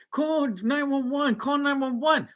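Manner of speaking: high, urgent imitation of a woman in a panic; hammed-up emergency plea; mock triggered. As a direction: plead in a mocking high-pitched voice. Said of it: "Homegirl gets triggered".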